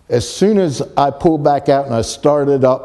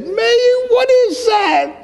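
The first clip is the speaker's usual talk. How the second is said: very high-pitched imitation of a scream; retold scream; slower than usual. Sis in the car sees the strange object, retold scream. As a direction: screech it as a frightened passenger would, without extra volume. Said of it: "She screamed so loud".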